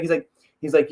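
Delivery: ordinary talk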